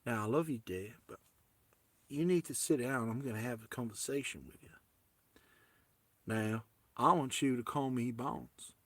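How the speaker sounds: sit her down gently in a fake American accent; quiet mock Southern American talk. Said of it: "please forgive my American accent".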